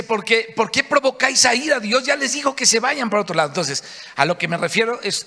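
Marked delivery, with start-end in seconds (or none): 0.0-3.5 higher and louder, exasperated scolding; scolding rebuke. Retold or imitated. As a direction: scold the people to stop. Moses scolding continues.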